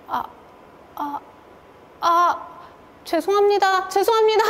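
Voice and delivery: 당황해 더듬다가 점점 높아지는 사과; 허둥지둥 높아지며 사과하듯; 당황한 사과. Retold or imitated. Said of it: "너무 당황해서". (당황해서 점점 높아지는 사과)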